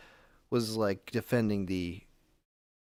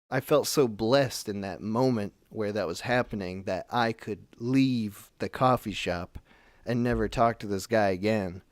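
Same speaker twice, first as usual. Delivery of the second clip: ordinary talk, nothing marked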